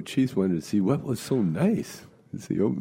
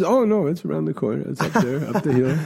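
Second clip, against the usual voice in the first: helpful passerby; 8 semitones higher, 4 dB louder than usual. Retold or imitated. Passerby giving directions, voiced.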